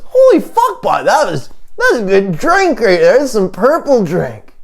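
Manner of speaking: high, excitable joke voice; supposed real voice. Acted. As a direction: pitch it way up, hammed-up. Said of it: "the actual sound of my voice".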